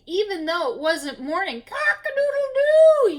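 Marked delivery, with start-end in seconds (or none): 1.6-3.2 high, loud rooster crow. A narrator's reading. Storyteller crows as the rooster for the kids. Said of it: "he screamed".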